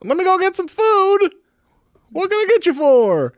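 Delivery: high, goofy eager intern voice; chirpy helper heading out for food; intern impersonation. Acted. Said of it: "funnier when we didn't impersonate Carl".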